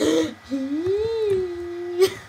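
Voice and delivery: long, high, drawn-out gasp; mock gasp. Friend acts out a donator's reaction, long exaggerated gasp. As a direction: suck in air in pretend amazement. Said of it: "They're like".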